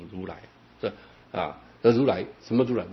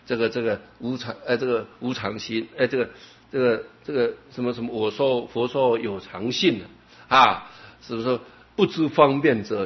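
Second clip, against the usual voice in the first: usual speaking voice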